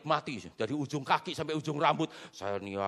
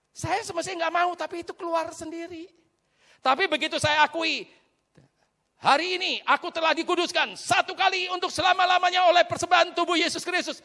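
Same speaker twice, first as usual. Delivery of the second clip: loud, raised declaration of faith; ringing confession to a congregation; preached declaration; 12 semitones higher, 7 dB louder than usual. Real, not acted. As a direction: proclaim it boldly, building higher and louder. Preaches at full force.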